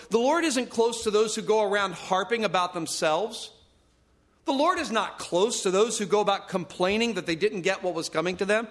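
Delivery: ordinary delivery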